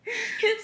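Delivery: short high-pitched laugh; high giggle. Real, not acted. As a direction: burst into a giggle. Laugh alone on line.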